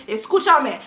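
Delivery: urgent command when things heat up; serious. Retold or imitated. When the heist is going wrong, serious and insistent. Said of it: "in such a serious manner".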